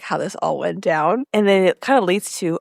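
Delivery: ordinary delivery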